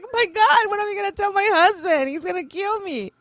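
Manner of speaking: high-pitched mock panic; retold panic. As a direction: squeal it like you're freaking out. Acting out her panic in the story.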